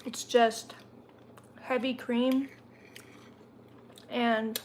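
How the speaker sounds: chewing while talking; mouth full. Real, not acted. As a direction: talk through a mouthful of food. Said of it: "sorry, I'm chewing".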